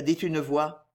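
ordinary delivery, nothing marked

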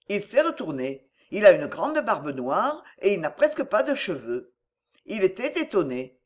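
plain reading voice

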